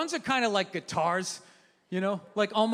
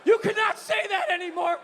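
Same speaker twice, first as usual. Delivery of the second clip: high-pitched mock scolding; emphatic scandalized reprimand, pitched up; wife flipping out; 8 semitones higher than usual. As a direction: imitate an outraged wife laying down the law. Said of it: "flips out".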